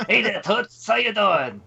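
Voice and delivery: put-on gruff wisecracking baby; brash little tough-guy baby; Baby Herman voice. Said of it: "Baby Herman?".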